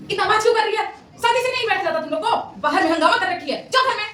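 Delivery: put-on high, loud, shrill-edged aunty telling kids off; scolding neighbour aunt. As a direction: yell at the noisy kids like a nosy auntie. Teacher voicing scolding neighbour aunt. (पड़ोस वाली aunty की तरह ऊँची, ज़ोर की डांट)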